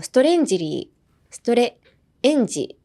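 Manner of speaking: "ストレンジリー" syllable by syllable, with added vowels; Japanese katakana pronunciation. Shown as a demonstration. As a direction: say "エンジ" with a short e, Japanese style. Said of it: "日本語を発音する方は". (「ストレンジリー」をカタカナ読みで)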